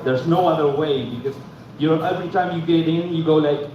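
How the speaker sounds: usual speaking voice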